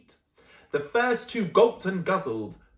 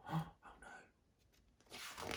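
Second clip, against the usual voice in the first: breathless, barely voiced oh no; mock gasp; 20 dB quieter than usual. Reader gasping at the danger, hushed and breathless. Teacher reacts to the story.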